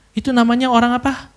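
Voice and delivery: usual speaking voice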